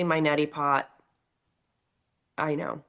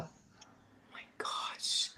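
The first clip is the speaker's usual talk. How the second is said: emotional whisper; 17 dB quieter than usual. Real, not acted. Touched by daughter's nomination.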